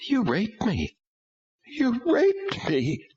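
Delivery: high tearful accusation, slowing; tears breaking through the words. A narrator's reading. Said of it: "She actually broke down, and tears".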